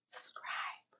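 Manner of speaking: very soft whispered plug. Real, not acted. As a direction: whisper it to the viewer. Whispered plea to viewers to subscribe.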